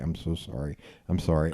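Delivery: low, soft repeated apology; meek apology. Retold or imitated. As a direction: imitate a cowed man apologizing. Man lifted up apologizes repeatedly.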